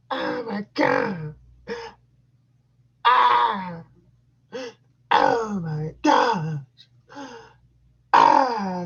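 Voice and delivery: high, loud hammed-up female moans; mock moaning woman. Retelling his partner going wild, hammed-up squeals. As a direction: squeal it like she's losing it. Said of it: "she just going ape shit".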